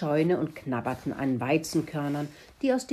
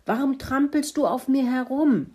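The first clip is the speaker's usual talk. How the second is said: high-pitched, grumpy pig complaint; roused sleeper's protest, pitched high; indignant bellow; 9 semitones higher, 4 dB louder than usual. Acted. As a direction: bellow it in outrage. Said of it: "brüllte Kalle".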